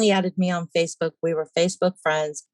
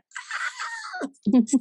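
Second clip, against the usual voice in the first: high giddy squeal-laugh. Real, not acted.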